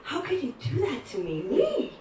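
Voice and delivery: mock-wounded protest with a squeaked last word; upset. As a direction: retell it as an affronted complaint. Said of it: "I was so upset".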